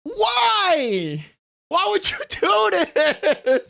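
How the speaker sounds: high, slow, laughing whine; laughing disbelief; laughing protest. Real, not acted. Streamer reacts to embarrassing meme.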